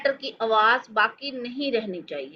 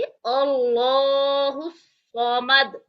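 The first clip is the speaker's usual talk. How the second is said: drawn-out, chanted and even; slow Quranic recitation; much slower than usual. Shown as a demonstration. Teacher reciting the verse for students, slowly chanted. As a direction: recite each syllable long and melodic. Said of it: "हम पढ़ेंगे".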